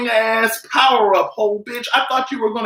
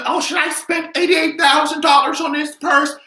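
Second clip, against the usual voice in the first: higher, shouted fake question; mock asking voice; 7 semitones higher than usual. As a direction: play the silly buyer asking. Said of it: "And you would say".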